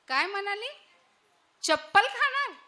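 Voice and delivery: high, startled disbelief; shocked mishearing. Acted. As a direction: jump at the word चप्पल in shock. Mishears chapati as sandal. (आश्चर्याने दचकून)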